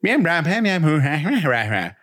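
put-on high, babbling baby talk; playful mock baby babble; imitating a babbling toddler. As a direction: mimic a toddler's nonsense sounds. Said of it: "where they look at their parents and are like".

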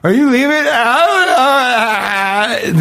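high, dragged-out clueless drawl. Pretend. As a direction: play dumb so the driver gives up. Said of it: "look almost kind of developmentally challenged".

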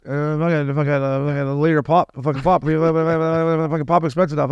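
imitating the cab driver's Newfoundland accent. Quoting the cab driver about pop prices, mild Newfoundland accent. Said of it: "you got a good accent there".

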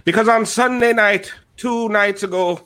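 hammy stage-Irish leprechaun; Lucky the Leprechaun. Acted. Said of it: "exclusive with Lucky the Leprechaun".

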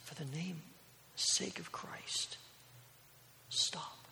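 slow, whispered final plea; acted failing breath, soft and halting; dying last breaths. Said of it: "With his last breaths".

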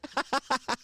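soft breathy laugh after the punchline; real laugh. Real, not acted. Cracking up at his own story, soft laugh. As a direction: laugh at your own line.

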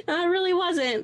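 said through a real laugh; words carried on a laugh. Real, not acted. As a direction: still laughing as you agree. Laughs at friend's ghost joke.

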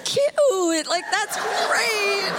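high, slow, laughing sarcasm; high sarcastic cute. Retold or imitated. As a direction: drawl it out high and amused. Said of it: "And I was like".

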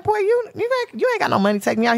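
high, sassy brush-off; sassy teen girl. Retold or imitated. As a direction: put the boy in his place. Her own sassy reply.

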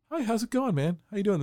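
sweet as pie before the snap; a put-on friendly hello; fake friendly. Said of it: "right as they're talking calmly to him".

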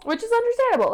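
squeaky high agreement; high-pitched echo. Real, not acted. Echoing listener, pitch jump.

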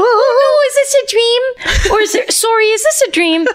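very high, airy, dazed woman; high dreamlike voice. Acted. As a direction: drift through it as in a trance. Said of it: "high and dreamlike".

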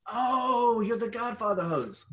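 high, loud burst of delighted surprise; sudden realization. Retold or imitated. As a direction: blurt it out like the penny just dropped. Realizing who the women are.

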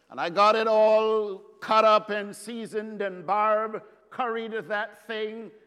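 ordinary delivery

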